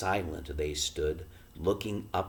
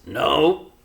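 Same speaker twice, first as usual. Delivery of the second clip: expressionless; 9 semitones higher, 10 dB louder than usual. A narrator's reading. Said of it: "in the same expressionless voice".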